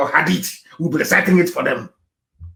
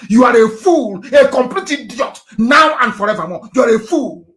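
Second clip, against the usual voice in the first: angry insult; 6 semitones higher, 4 dB louder than usual. Real, not acted. Insulting Nigerians who claim pride.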